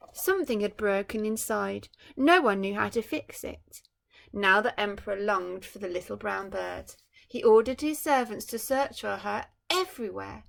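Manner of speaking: bright storybook reading voice; story narration. A narrator's reading. Parent reading a picture book to a child, storytelling. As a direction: read it like a bedtime picture book.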